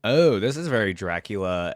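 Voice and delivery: ordinary talk, nothing marked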